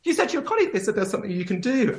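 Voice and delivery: high mock pleading; hammed-up desperate traveller; whiny protest. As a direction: whine it up high like a stranded passenger. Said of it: "they'd be like".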